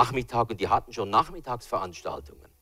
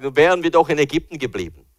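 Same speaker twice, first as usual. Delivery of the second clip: higher, louder whining lament; complaining Israelites; 4 semitones higher, 8 dB louder, faster than usual. Retold or imitated. Israelites complaining to Moses in the desert, loud whining. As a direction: wail that you'll die in the desert. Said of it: "Und sagen".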